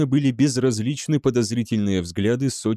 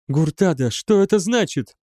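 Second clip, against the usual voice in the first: high-pitched, alarmed asking; worried, rising voice; frightened question; 6 semitones higher than usual. A narrator's reading. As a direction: ask anxiously, pitch climbing. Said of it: "испуганно спросила она".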